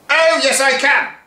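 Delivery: teacher yelling at the school; pantomime retort. Acted. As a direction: shout back like a panto villain. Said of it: "pantomime routine".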